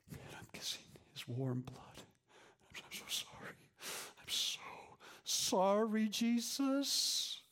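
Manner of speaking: broken, whispered apology; tearful whisper. Acted. Preacher whispering repentance to Jesus at the cross. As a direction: whisper sorry like your heart is breaking.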